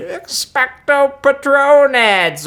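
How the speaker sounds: high, slow, drawn-out mock yell of a spell; mock spell scream. Mocking Harry casting a spell, a slow high mock scream. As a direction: ham up a wizard screaming his spell.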